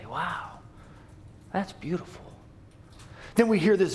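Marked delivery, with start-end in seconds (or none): 0.1-2.3 soft, breathy-free murmured wonder; whisper-quiet amazement at the screen; quietly awed moviegoer; hushed awe. Acted. Audience voiced in awe.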